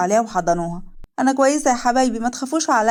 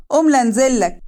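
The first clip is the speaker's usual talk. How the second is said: higher, louder call down the stairs; threatening call from upstairs; 4 semitones higher, 5 dB louder than usual. Acted. Wife calls down threatening to come downstairs, raised voice. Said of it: "ورنا من فوق".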